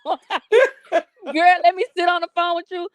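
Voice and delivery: high, laughing mock of the call; mocking through laughter. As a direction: rattle it off fast while laughing. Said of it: "Ain't fucking said".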